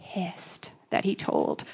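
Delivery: very soft, drawn-out whisper; whispered confession. Real, not acted. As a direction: drop to a slow whisper on the word. Confiding anger in a whisper.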